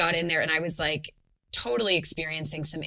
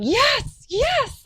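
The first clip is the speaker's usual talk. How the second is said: ecstatic shouted yes, very high and loud; screaming yes; 9 dB louder than usual. Retold or imitated. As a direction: scream it out in sudden joy. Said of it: "like, screaming".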